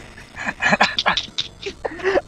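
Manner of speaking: high breathless wheeze; wheezing laugh. Real, not acted.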